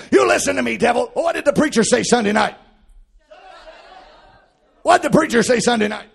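loud, high shouted preaching; devil's accusations hurled at full shout; full-force preaching. Acted. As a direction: preach it hard to the congregation.

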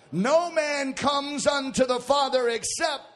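loud, high climactic shout; preaching shout. Acted. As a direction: proclaim it at the top of your voice.

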